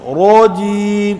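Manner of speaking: روجی with a thin light را, drawn out slowly; wrong pronunciation. Shown as a demonstration. Teacher mocking the common mistake in روجی. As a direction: say روجی stretched, the را thin instead of heavy. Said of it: "تو یہ غلط ہے".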